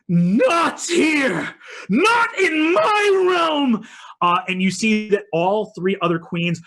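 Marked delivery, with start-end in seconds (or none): 0.1-3.9 high, drawn-out wailing shout; enraged queen shrieking her refusal; furious yell. Acted. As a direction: scream it out in full outrage. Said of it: "the Queen of Dusk yells".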